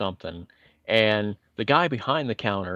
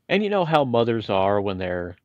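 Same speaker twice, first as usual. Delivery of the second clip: ordinary talk, nothing marked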